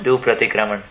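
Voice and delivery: usual speaking voice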